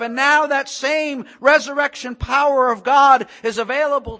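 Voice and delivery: loud, high, building proclamation; full-force preaching. Real, not acted. Preacher proclaiming the resurrection to the congregation, impassioned preaching. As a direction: proclaim it with mounting fervour.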